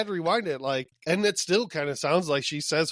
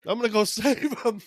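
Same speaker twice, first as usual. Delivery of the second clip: mock dog voice; 5 semitones higher than usual. Voicing dog Shimmer.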